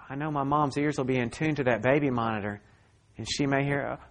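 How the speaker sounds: usual speaking voice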